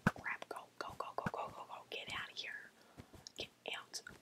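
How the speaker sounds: very soft, breath-only urging; urgent whisper. Real, not acted. Player fleeing approaching enemies, urgent whisper. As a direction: whisper frantically to get away.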